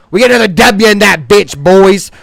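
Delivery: pumped-up fan boast; hyped shout. Real, not acted. Hypes his team's win.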